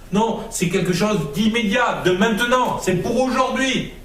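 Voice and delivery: soaring pulpit cry; fiery preaching. Acted. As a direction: preach it out, rising to a peak. Preaches at full force.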